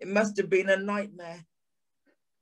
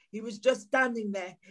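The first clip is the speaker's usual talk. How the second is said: ordinary talk, nothing marked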